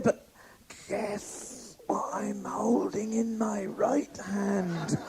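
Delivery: slow creepy whisper; whispered caller. Retold or imitated. Obscene caller teasing her in a whisper.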